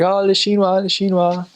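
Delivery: high, sing-out mimicry of onlookers; imitated local callers. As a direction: call it out like excited onlookers. Locals calling him Chinese, acted out.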